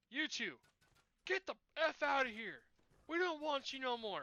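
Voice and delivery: cartoon dad yelling the kids out; mock angry yell. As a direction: throw them out like a furious cartoon dad.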